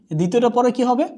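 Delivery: normal talking voice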